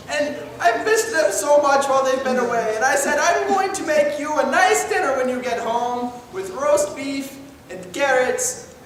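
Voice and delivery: high, loud doting mom voice; overexcited mother. Acted.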